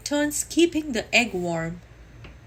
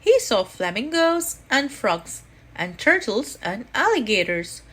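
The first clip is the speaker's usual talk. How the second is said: usual speaking voice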